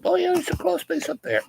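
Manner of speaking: high light voice of the lady; lightly voiced homeowner, high and soft.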